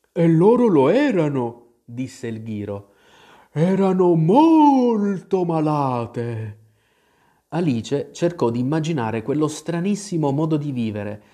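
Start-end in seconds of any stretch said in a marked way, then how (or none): 0.1-1.7 louder, higher drowsy creature voice; put-on dozy storybook animal; sleepy Dormouse. A narrator's reading. Sleepy Dormouse answers Alice. 3.5-6.6 very slow, louder, higher drawl; drowsy, dragged-out creature voice; sleepy Dormouse. A narrator's reading. Sleepy Dormouse stresses how ill.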